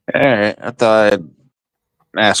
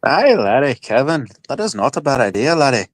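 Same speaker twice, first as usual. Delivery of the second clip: higher-pitched tipsy Irish brogue, laddie and all; Irish accent; 7 semitones higher than usual. Acted. Replying to Kevin in chat, in a thick Irish accent. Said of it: "that accent comes out super fucking heavy".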